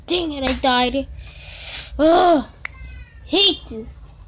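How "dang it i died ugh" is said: ordinary delivery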